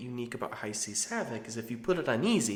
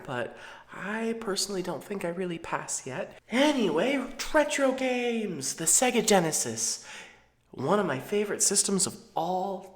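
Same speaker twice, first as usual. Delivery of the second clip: practicing a higher effeminate voice; 6 semitones higher, 3 dB louder than usual. Real, not acted. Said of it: "I'm practicing my more effeminate voice".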